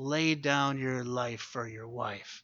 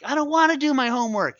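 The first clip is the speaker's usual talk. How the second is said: put-on high, loud, fast kid complaining; whiny child; 9 semitones higher, 9 dB louder, faster than usual. Child refusing homework to parent, loud whiny high voice. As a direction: whine like a kid dodging homework.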